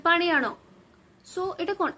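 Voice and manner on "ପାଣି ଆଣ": demonstrated raised-voice order; a mild shouted command; mock shout. Shown as a demonstration. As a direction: call it out as if annoyed. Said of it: "ମୁଁ ଚିଲେଇ କହିବି".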